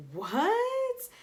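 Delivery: shrieked, very high shocked what; mock shocked squeal. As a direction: squeak it in disbelief. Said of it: "I'm like".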